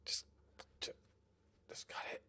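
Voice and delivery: unvoiced whisper under his breath. Real, not acted. Gamer urging a transfer to himself, whispering. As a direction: barely breathe the words to yourself.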